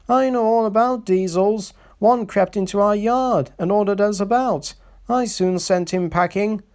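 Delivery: high, proud boasting voice; boastful James. A narrator's reading. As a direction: brag like you own the yard.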